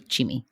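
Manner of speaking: "Chimi" said CHEE-mee; two syllables in "Chimi", chee-mee; shortened nickname. Shown as a demonstration. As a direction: say "Chimi" with a ch and long ee. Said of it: "cut off the L A".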